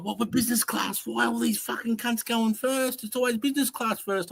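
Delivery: high whining moan; high-pitched petulant whinge; whiny complaint. Retold or imitated. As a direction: whine like a little dog. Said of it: "I was spewing".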